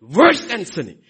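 "Worse" punched loud and falling; stress on worse. Real, not acted. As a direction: lean hard into "Worse".

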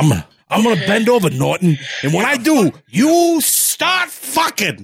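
high, hammed-up comic character; exaggerated sitcom-husband bluster; stand-up routine impression. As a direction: rush in, then stretch the punchline way up high. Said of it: "talking about Ed Norton saying".

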